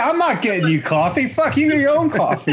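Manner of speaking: high-pitched, laughing mock refusal; mock outrage. Fearless colonel refusing to fetch coffee, in laughing mock outrage. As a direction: play the indignant refuser, half laughing.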